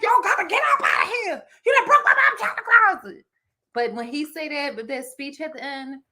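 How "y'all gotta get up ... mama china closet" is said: high, loud mock scolding with a laugh; mother scolding. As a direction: imitate the upset mother yelling at the kids. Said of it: "And she be like".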